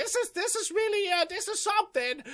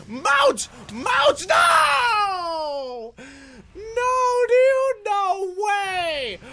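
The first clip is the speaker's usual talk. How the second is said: dismayed shouting; 6 dB louder, much slower than usual. Real, not acted. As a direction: wail in protest as it all goes wrong. Failed escape, character dies.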